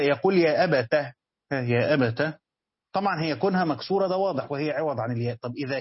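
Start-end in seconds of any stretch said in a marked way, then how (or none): 0.4-2.3 يا أبته with the ta opened, ending on a clear a; open ta demo. Shown as a demonstration. Teacher demonstrating the open ta reading to students, clear and deliberate. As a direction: say يا أبته with fatha on the ta, not kasra. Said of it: "ومن يفتح التاء".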